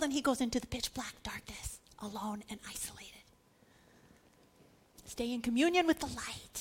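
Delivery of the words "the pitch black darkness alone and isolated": fading, breathless whisper into the dark; hushed whisper. A narrator's reading. Preacher describing Judas leaving into night, hushed whisper. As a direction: whisper it like a secret going dark.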